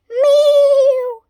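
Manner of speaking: high, loud wailing meow; loud cat cry. A narrator's reading. Wilbur the cat calling to Winnie, loud meow. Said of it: "he cried".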